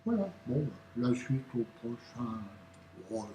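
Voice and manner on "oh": ordinary talk, nothing marked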